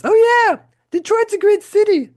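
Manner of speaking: very high, loud glam-rock purr. Retold or imitated. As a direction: croon it like a rock star. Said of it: "He goes".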